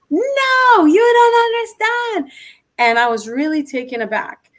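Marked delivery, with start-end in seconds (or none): 0.1-2.3 much higher, drawn-out yell; retold yell. Upset woman insisting she is not understood, yelling. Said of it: "then she yelled at me".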